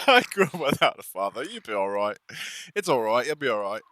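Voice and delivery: teasing, hammed-up British accent; mock British accent. Streamer mimics a friend's sad backstory, mock British accent. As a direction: put on a rough British accent. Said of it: "What was that British accent".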